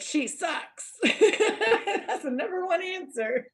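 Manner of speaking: high-pitched words through laughter; giggly game-show punchline; laughing. Real, not acted. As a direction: crack up at your own joke. Laughing at own Family Feud joke.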